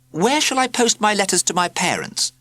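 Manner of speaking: put-on high, eager boy's voice; a small boy asking innocently; young boy asking. A narrator's reading. Boy asks classmates where to post letters, high and eager. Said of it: "Huxley asked".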